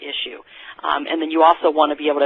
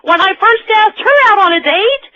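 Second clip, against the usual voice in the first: hammed-up suitor; 4 dB louder than usual. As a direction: ham up a hopeful pickup line. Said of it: "And you go".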